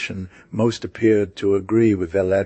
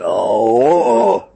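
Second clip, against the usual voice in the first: a much louder drawn-out oh; exclaimed; 7 dB louder than usual. A narrator's reading. The neighbor cries out against his opponents, loudly. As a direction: burst out in scornful disbelief. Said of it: "he exclaimed".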